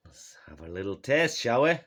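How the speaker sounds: normal talking voice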